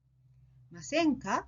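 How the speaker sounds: ませんか ending on a clear upward tune; rising question. Shown as a demonstration. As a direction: let "ませんか" climb at the end. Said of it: "with an upward intonation".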